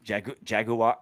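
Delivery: lightly British Jaguar, JAG-yoo-ah; British pronunciation. Retold or imitated. Host copies co-host's British way of saying it, British accent. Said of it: "the British say it".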